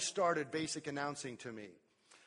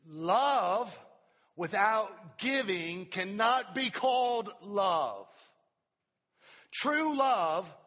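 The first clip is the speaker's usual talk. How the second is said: fervent preaching; 4 semitones higher, 9 dB louder, much slower than usual. Real, not acted. Preaching at full force.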